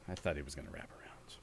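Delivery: quick unvoiced whisper to himself. Real, not acted. Muttering to himself after losing the round, quick whisper. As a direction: breathe it out fast under the breath.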